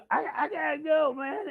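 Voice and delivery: put-on high, loud drunken farewell; wasted rocker. Wasted guy saying goodbye to a stranger, high loud whine. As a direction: cry it out like an emotional drunk. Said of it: "blitzed out of his mind".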